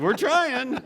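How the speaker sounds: loud, high-pitched comeback; retold exasperation, played for laughs; exasperated protest. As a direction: protest helplessly, voice jumping up. Retells his exasperated reply to her.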